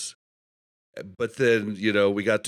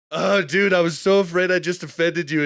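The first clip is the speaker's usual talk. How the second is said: relieved outburst; 8 semitones higher, 7 dB louder than usual. Retold or imitated. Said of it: "I was like".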